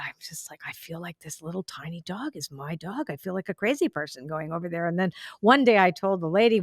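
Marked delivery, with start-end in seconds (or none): 0.0-4.9 retold in a much softer, almost hushed voice; quiet embarrassed admission to a new boyfriend; hushed confession. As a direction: sheepishly confide it under your breath. Confessing odd feeling to new boyfriend.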